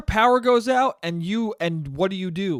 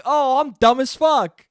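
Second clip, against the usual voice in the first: loud, high, drawn-out mock stupidity; hammed-up jeer at yourself; self-mockery; 7 semitones higher, 6 dB louder than usual. Host scolding himself as an idiot, self-mockery.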